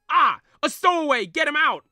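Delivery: loud high alarmed shout; yelling. Acted. Sailor spotting the stowaway, loud alarmed shout. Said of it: "Red yells".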